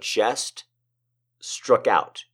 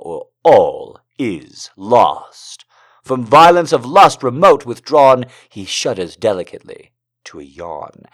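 measured, dramatic poem reading; poetry reading; 6 dB louder than usual. Acted. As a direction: let each line land slowly. Reads a poem aloud.

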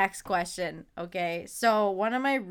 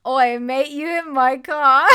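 loud, high-pitched mock Australian; Australian accent; 6 semitones higher, 13 dB louder than usual. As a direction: belt out a hammed-up Australian accent. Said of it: "let's start with Australian".

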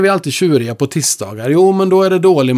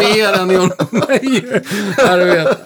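very slow, higher, drawn-out brag; mock grand boast; 6 semitones higher, much slower than usual. [name] tops his joke about [name], slow and grand. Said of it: "mock boast".

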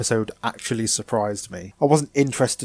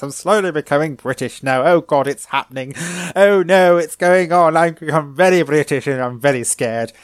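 hammed-up British accent, building louder and higher; awful British accent; 4 semitones higher, 5 dB louder than usual. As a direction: slide into an exaggerated, panicky British accent. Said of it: "sorry about the awful British accent".

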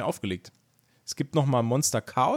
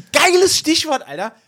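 excited; 12 semitones higher, 8 dB louder than usual. Real, not acted. Excited outburst at drinking topic.

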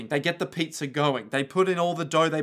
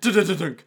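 loud rattling thud made with the voice; quick stuttered knock ending in a thunk; imitated clatter; 6 dB louder than usual. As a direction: voice a mop tumbling over. Imitating the mop falling over.

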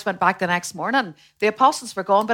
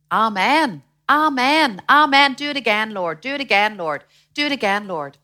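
rising, louder cries of praise; fervent acted amens; 3 semitones higher, 5 dB louder than usual. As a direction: call out like an eager congregation. Acts out congregation's fervent responses.

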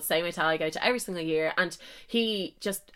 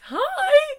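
very high, loud squeaky hi; nervous squeal of a greeting; awkward hi; 7 dB louder than usual. Retold or imitated. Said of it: "You know, when you go to say hi".

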